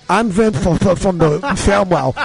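talking like a bitten, bleeding tongue; muffled, lisping tongue-bitten pitchman. Retold or imitated. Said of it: "If I sound funny, it's because my tongue is bleeding".

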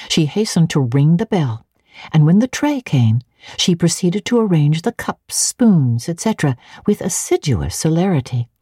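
ordinary delivery

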